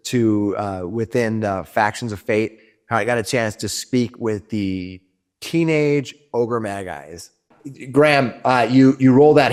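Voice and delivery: ordinary talk, nothing marked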